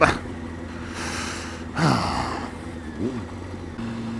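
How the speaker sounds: weary, drawn-out sigh; long sigh. Real, not acted. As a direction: let out a long tired sigh. After stalling the bike.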